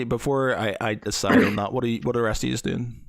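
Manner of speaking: normal talking voice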